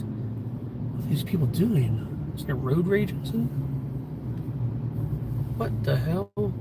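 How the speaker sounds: low, slow under-the-breath mutter at traffic; muttered aside. Real, not acted. While driving, he mutters about other drivers' road rage, softly and slowly.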